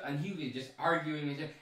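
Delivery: ordinary talk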